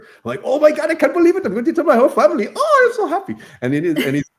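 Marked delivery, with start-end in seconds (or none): 0.4-3.4 much higher, louder and fast overjoyed squeal; gushing fan. Retold or imitated. Fan promising to tell family, high fast squeal. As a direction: burst with starstruck joy.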